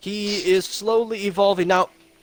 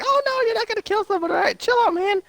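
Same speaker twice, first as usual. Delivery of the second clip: put-on much higher, fast, squeaky kid voice; high squeaky Spider-Man. Friend retelling the movie, voicing Spider-Man high and fast. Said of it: "And Spider-Man's like".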